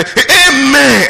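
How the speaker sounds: mock amen. Mocking the congregation's answer.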